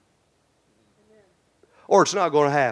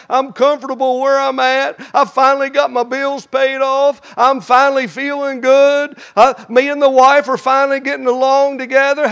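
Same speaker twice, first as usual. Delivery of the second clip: louder, higher complaining rant; mad at God; 8 semitones higher, 7 dB louder than usual. Pretend. A comfortable believer angrily telling God to leave him alone. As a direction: push God away, fed up. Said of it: "if you get mad and say".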